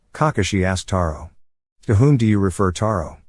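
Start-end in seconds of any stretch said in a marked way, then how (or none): none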